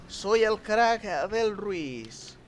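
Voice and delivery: drawn-out, lifted comic voice; put-on boasting voice. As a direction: repeat the brag in a silly stretched voice.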